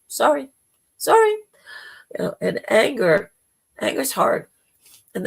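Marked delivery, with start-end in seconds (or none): 0.1-0.5 a touch louder, lightly dismissive sorry; mock apology. Refusing friends' requests for help, lightly dismissive. Turning away people asking help. 1.0-1.3 loud, high, hammed-up sorry; mock apology. Refusing friends' requests for help, a loud high mock apology. Turning away people asking help.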